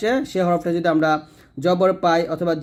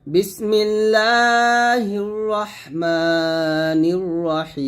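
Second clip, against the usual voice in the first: slow, drawn-out chanted recitation; measured melodic Quranic chant; 3 semitones higher, much slower than usual. Shown as a demonstration. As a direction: recite it like tajweed, stretching each word. Recites bismillah.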